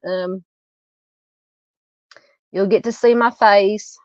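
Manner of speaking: ordinary delivery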